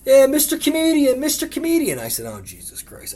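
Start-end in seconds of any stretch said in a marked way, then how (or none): 0.0-2.0 high, loud mocking heckle; heckling from crowd. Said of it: "this heckler is going". 2.2-3.2 muttered aside. Retold or imitated. Exasperated aside at heckler.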